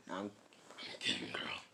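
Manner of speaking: soft whispered beast rasp; weak attempt at a monster voice; Kurama. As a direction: rasp it quietly, without voice. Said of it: "my Kurama voice isn't as good".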